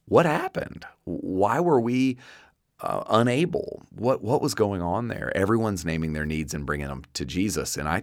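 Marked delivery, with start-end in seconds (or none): 0.1-5.3 higher-pitched baffled questioning; puzzled disciples. Retold or imitated. Disciples come to Jesus after failing, puzzled and higher-pitched. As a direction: imitate bewildered followers asking what went wrong.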